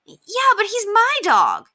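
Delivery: put-on high, slow boyish plea; a young boy explaining, upset; boy pleading. A narrator's reading. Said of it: "Henry tried to explain".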